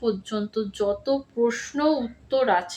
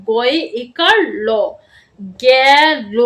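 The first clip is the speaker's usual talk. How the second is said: spelling out; 6 dB louder, much slower than usual. Shown as a demonstration. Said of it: "আমি কিভাবে বলছি".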